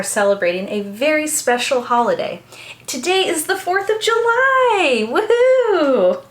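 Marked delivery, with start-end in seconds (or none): 2.9-6.2 loud high woohoo; a party whoop; excited cheer. Real, not acted. Celebrating Fourth of July.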